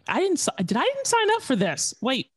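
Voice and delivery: a high, startled mock panic at the news; retold alarm. Telling the host how he reacted to the general's warning, stunned and high. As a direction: pitch jumps way up in stunned disbelief. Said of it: "I was like".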